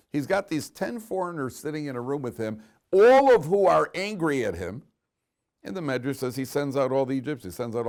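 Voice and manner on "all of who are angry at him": loud, higher burst of emphasis; emphatic. Real, not acted. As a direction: raise the voice to stress the danger. Emphasizes the danger.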